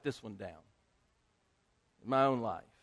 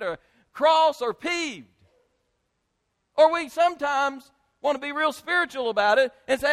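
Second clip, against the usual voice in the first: high, loud sermon delivery; impassioned preaching; 8 semitones higher, 9 dB louder than usual. Real, not acted. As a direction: drive the point home from the pulpit. Preaches on admitting anger.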